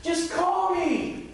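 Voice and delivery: exasperated God. Retold or imitated. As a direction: wave your arms, I'm right here. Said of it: "sometimes he's like".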